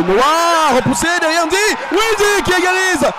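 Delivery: high excited goal call; excited sports commentary. Real, not acted. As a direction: build up and celebrate.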